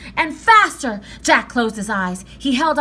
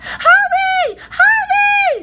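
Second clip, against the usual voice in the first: very high, loud panicked scream; 10 dB louder, much slower than usual. A narrator's reading. Annie screaming at Jack climbing the ladder, panicked. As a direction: scream at him to hurry. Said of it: "screamed Annie".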